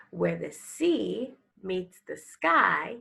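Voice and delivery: ordinary delivery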